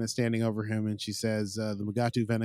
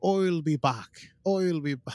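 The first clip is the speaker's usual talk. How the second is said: copied mock-Irish action catchphrase; higher, attempted Irish with broad oy; Arnold Schwarzenegger; 5 semitones higher, slower than usual. Host copying cohost's example, mock Irish accent. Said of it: "Arnold Schwarzenegger were to have done an Irish accent".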